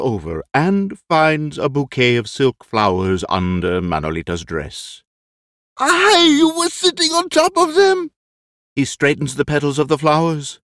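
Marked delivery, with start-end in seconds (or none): 5.8-8.1 very high, startled cry; shocked squeal. Acted. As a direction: squeal in dismay. Lolo finds the crushed flowers.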